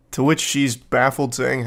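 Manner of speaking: ordinary delivery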